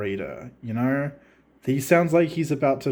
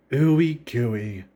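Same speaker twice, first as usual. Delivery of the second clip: knowing irony; 3 semitones lower than usual. Retold or imitated. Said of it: "He's aware. He knows the irony".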